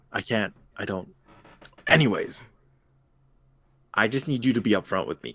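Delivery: usual speaking voice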